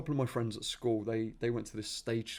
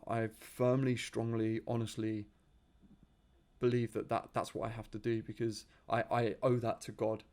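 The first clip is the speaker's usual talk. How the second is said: usual speaking voice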